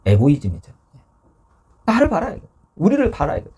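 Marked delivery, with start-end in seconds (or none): 1.9-3.5 high-pitched imitation of self-centred griping; hammed-up, indignant whine of protest; mock resentful complaint. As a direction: act out demanding attention, pitched high. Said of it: "예수님께 원망을 쏟아냅니다".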